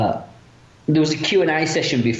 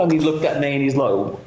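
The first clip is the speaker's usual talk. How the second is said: ordinary delivery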